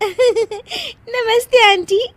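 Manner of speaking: high, slow greeting after a giggle; giggly greeting. Acted. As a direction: greet them while holding in laughter. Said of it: "हंसने वाली दवा खा लेती है". (हंसी के साथ ऊंची आवाज़ में नमस्ते)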